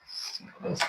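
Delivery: breathy whispered "sílabas" to herself; whispered correction. Real, not acted. Teacher whispering a self-correction. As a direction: mutter the word under the breath.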